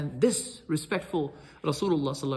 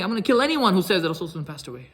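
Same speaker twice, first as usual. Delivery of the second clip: loud, raised, furious threat; yelling threat; 4 semitones higher, 6 dB louder than usual. Retold or imitated. Umar, sword out, threatening the crowd, loud yell. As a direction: shout it at everyone in the mosque. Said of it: "And he's yelling".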